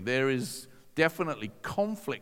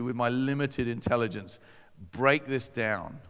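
normal talking voice

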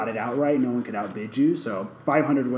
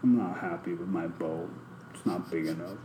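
lower, much softer whiny grumble; sulky complaint; 8 semitones lower, 11 dB quieter than usual. Retold or imitated. To friends, mocking a miserable rich man, sulky mumble. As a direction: sulk over your boat. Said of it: "sitting there like".

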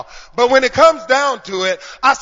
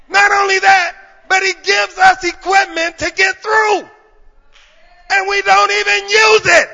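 loud, higher, shouted praise; full-force preaching; 5 semitones higher, 7 dB louder than usual. Acted. Preacher praying aloud for the church, shouting praise. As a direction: cry it out to God.

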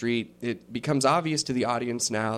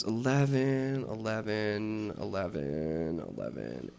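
gnome clerk; 2 semitones lower, 6 dB quieter, slower than usual. Acted. Gnome clerk counting down the ledger, slowly fading mutter. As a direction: trail off while scanning the pages.

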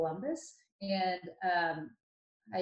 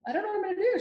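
high, louder tearful wail, imitating her younger self; retold crying; 13 semitones higher, 5 dB louder, much faster than usual. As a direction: replay the teary phone call to mom, voice cracking high. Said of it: "I remember crying on the phone to my mom saying".

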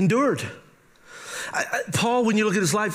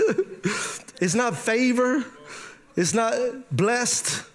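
usual speaking voice

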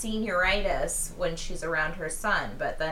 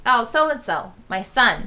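loud mock introduction; raised-voice imitation of a mother presenting her son; introducing mother; 2 semitones higher, 8 dB louder than usual. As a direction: announce it like a showy old mother. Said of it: "she was like".

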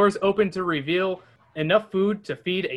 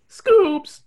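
much higher, louder Shaggy cry; botched Shaggy voice; 14 semitones higher, 8 dB louder than usual. Acted. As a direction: try for Shaggy and crack. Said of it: "Damn it".

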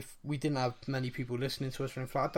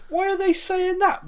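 put-on much higher, louder little-kid voice; young kid asking; 9 dB louder than usual. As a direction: play his younger self, puzzled and curious. Young self asking older brother.

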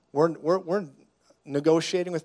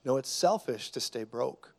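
ordinary talk, nothing marked

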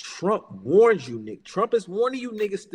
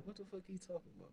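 fast, much softer, under the breath; muttered disbelief; 24 dB quieter, much faster than usual. Retold or imitated. Hearing a billionaire's odd remark, muttering baffled under the breath. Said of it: "I was like".

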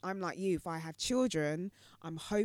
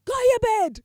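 much higher, loud stern command; angry shout; 16 dB louder than usual. Retold or imitated. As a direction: bark it at the child. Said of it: "She said".

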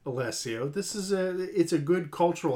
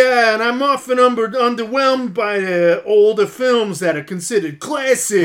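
loud, higher-pitched New Yorker; thick New York accent; 5 semitones higher, 7 dB louder than usual. Acted. Hosts trading lines of a listener's comment, thick New York accent. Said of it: "Thick New York accent".

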